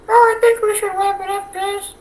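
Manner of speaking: high voice. Pretend. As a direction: speak up high like a kid. Answers as the viewers.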